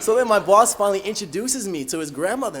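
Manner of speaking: ordinary talk, nothing marked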